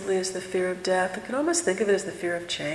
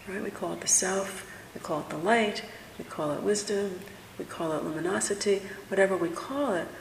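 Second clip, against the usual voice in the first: ordinary talk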